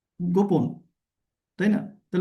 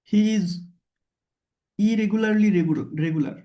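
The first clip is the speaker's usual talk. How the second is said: slow example; much slower than usual. Shown as a demonstration. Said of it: "সে অনিয়মিতভাবেই নিয়মিত".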